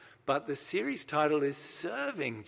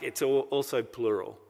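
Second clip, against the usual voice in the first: usual speaking voice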